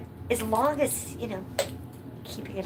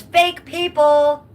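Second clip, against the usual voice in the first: mock loud quote; 8 semitones higher, 9 dB louder, much slower than usual. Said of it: "Charles says".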